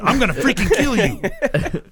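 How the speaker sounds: mock furious threat, high and drawn out; Sam threatening. Boss phoning his new employee, imitated high-pitched furious threat. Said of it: "he's already threatening".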